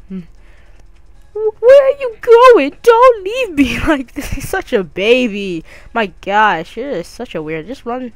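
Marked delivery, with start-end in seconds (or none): 1.3-4.3 hammed-up panic. Birch pleading as player leaves.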